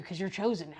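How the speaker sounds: ordinary talk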